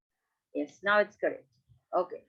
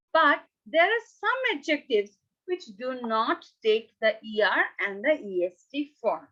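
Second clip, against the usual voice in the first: ordinary talk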